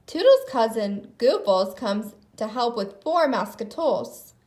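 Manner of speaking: usual speaking voice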